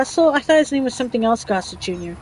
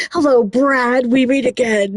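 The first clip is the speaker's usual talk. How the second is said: slow, louder villain greeting; ominous villain greeting; 3 dB louder than usual. Retold or imitated. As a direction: greet him like a movie villain meeting his foe. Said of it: "Why did I say it like that?".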